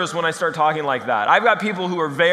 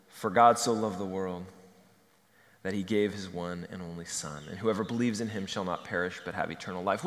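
much lower, softer reverent reading; quiet scripture reading; 12 semitones lower, 8 dB quieter than usual. Acted. Preacher reads John to the congregation, quiet and reverent. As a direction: read the verse softly, with reverence.

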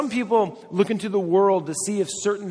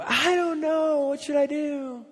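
higher put-on voice of a helpless friend; whiny indecisive friend; 11 semitones higher, slower than usual. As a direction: whine it like someone who can't decide.

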